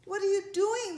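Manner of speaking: high, loud outburst; indignant yell. A narrator's reading. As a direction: shout it in shock as the ladybug. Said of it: "the ladybug yelled".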